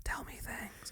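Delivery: hushed, unvoiced begging whisper; mock-conspiratorial whisper; whispered plea. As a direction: whisper it like a secret request. Retelling eager pleas to Aussie colleagues.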